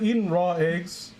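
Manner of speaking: usual speaking voice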